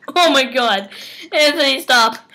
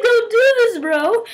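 high mock plea through laughter; imitating friend; 10 semitones higher, slower than usual. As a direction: play his panicked plea, laughing. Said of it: "he's like".

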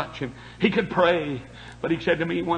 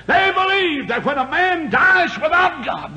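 higher, louder repeat of the line; re-emphasized; 11 semitones higher, 6 dB louder than usual. Acted. Preacher re-emphasizing that men go to hell, louder. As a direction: hammer the phrase home. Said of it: "I want to re-emphasize that".

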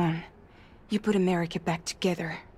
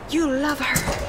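raised, urgent insistence; insistent cry; 8 semitones higher, 6 dB louder, much slower than usual. Acted. Urging Sam to feel his love for Amelie, loud insistent cry. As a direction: push it out loud to convince him.

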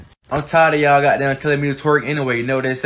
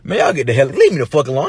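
ordinary talk

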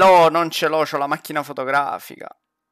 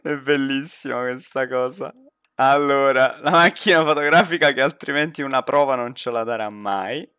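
ordinary talk

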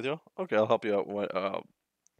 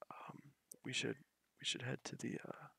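whispering; 3 semitones higher, 15 dB quieter than usual. Real, not acted. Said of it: "Why are we whispering?".

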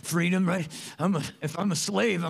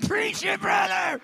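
excited shout; 12 semitones higher, 4 dB louder, slower than usual. Retold or imitated. Preacher cheering the quoted man.

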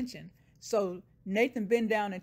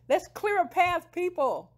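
loud, high crowd-clearing call; imitated commanding yell; acted shouted order; 10 semitones higher, 7 dB louder than usual. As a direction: holler to move a crowd. Nathan orders the crowd to move.

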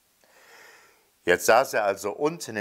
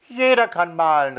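put-on high, louder child's call; boy calling out; 8 semitones higher, 6 dB louder than usual. A narrator's reading. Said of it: "rief der Junge". (hohe, laute Kinderstimme, rufend)

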